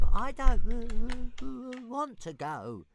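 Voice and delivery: high dragon voice, stammering but calm; a small dragon politely refusing; reasonable dragon. A narrator's reading. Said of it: "Toothless pointed out, equally reasonably".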